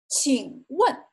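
请问 with dipping 请 and sharply falling 问; tone demonstration. Shown as a demonstration. Teacher showing learners third tone and fourth tone, careful demonstration. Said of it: "third tone and fourth tone".

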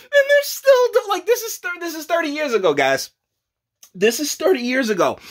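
can't believe it's still going on; worked-up incredulous rant. Real, not acted. Incredulous rant about still doing it.

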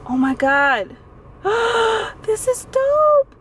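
high, gasping squeal, slowing on the last words; excited yelling. Real, not acted. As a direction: squeal it in real delight. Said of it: "I'm sorry if I'm yelling".

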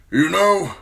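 put-on loud, high-pitched grumble from an old officer; grumbling old commander. A narrator's reading. Commander answers his young steward, grumbling loudly. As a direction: grumble back at him, loudly. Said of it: "Mormont grumbled".

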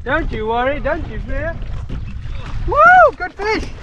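ordinary talk

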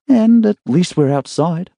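a hint of a groggy young woman just waking; young woman's voice. A narrator's reading. Emma voiced, higher.

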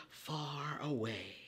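slow, hushed whisper. Acted. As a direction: breathe it like a secret. Storybook mystery phrase.

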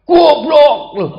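high, very loud insult shout; explosive mock-angry outburst; angry yelling. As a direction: yell it like someone enraged at close range. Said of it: "Deket tapi teriak".

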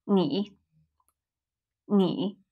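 你 low and soft, dipping. Shown as a demonstration. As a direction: keep 你 down low. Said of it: "Still a low tone".

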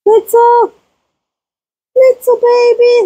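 very high cooing. Real, not acted. Cooing at young goat Blitzel, very high. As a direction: coo her name. Baby talk to goats.

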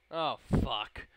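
loud burst of alarm; punchy, loud swearing; loud dismay. Real, not acted. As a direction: curse loudly in dismay. Reacts to reply backfiring.

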